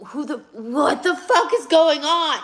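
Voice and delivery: loud, high laughing outburst; laughing exasperation. Real, not acted. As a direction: throw up your hands, laughing. Ranting about John's behaviour.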